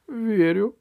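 high, unhappy agreement; joyless voice. Acted. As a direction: agree without any joy. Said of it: "голос его не выражал никакой радости". (безрадостно, высоким голосом)

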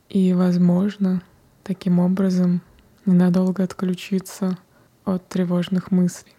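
ordinary talk, nothing marked

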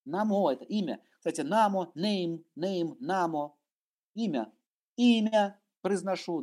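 ordinary talk

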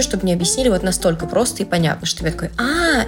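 usual speaking voice

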